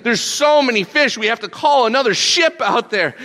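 high, loud, thrilled storytelling; bursting with the tale of the catch; amazed excitement. Acted. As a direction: marvel loudly at a huge haul. Retelling the huge catch of fish.

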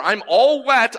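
ordinary talk, nothing marked

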